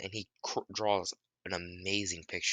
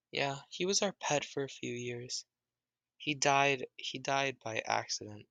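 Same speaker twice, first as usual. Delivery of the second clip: a hint of a higher child voice; lightly voiced kid; 7 semitones higher than usual. Kid telling Naruto his dog died, lightly voiced. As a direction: keep it a little high and young.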